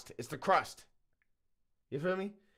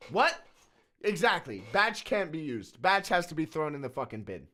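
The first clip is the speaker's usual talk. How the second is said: normal talking voice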